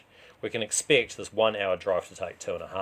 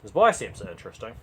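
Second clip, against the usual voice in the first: ordinary delivery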